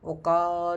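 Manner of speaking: "ओका" with the a held long; showing that the a in okaa must be stretched; long vowel. Shown as a demonstration. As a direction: hold the a in "ओका". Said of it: "तो थोड़ा लंबा बोलना पड़ता है".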